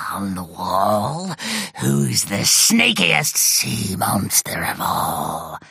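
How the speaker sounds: low, slow monster chant; sea monster voice. A narrator's reading. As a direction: growl it like a hungry sea monster. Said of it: "monster chants at the mirror".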